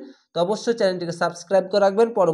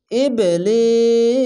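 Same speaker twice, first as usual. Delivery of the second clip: ইবলিস drawn out very slowly in a chanted recitation voice; Quranic recitation; 3 semitones higher, 3 dB louder, much slower than usual. Shown as a demonstration. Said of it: "তার জন্য আমি পড়ে নিলাম".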